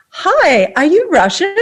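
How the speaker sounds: high-pitched, retold eager question; eager greeting. Speaker retelling how she approached a Russian guy, eager greeting. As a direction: pitch it up as a friendly opener.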